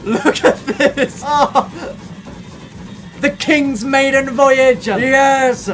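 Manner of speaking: loud, high, slow excited cheering. Real, not acted. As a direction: savor the big moment loudly. Maiden voyage excitement.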